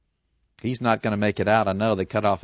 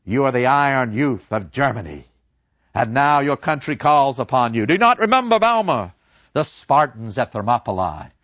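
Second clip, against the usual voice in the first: rousing professor; 5 dB louder than usual. Retold or imitated. Professor urging his class to enlist, rousing and loud. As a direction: stir the boys to fight. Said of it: "Professor Kantorek came in and said".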